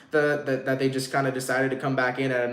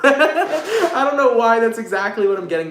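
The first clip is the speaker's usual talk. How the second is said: laughing aloud, higher and louder; 9 semitones higher, 8 dB louder than usual. Real, not acted. Tarot reader laughing at odd message to viewers. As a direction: burst out laughing at the card. Laughing at her own reading.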